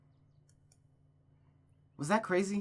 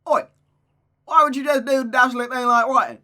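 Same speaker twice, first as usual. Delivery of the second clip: loud, high mock-British outburst; Gavin Free impression; 11 semitones higher, 13 dB louder than usual. Character challenging her friend about writing, mock-British accent. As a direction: shout it in a hammed-up British accent. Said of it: "just completely change your accent".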